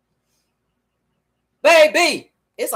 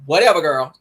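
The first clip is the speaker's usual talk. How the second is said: a lower, offhand brush-off; mild dismissive Sheree; 6 semitones lower than usual. Retold or imitated. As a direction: wave her off like Sheree would. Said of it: "Sheree be coming after her ass and be like".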